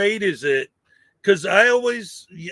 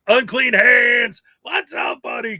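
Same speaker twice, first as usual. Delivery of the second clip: calling out; 5 semitones higher, 6 dB louder than usual. Real, not acted. Streamer spots a regular in chat, big excited shout-out. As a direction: holler the name, then jump up high. Host calls out a viewer.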